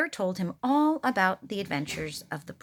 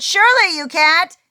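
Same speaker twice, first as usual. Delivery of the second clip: higher, louder disbelief; exasperated outburst; 10 semitones higher, 15 dB louder than usual. A narrator's reading. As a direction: cry it out in disbelief.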